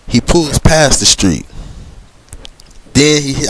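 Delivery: ordinary delivery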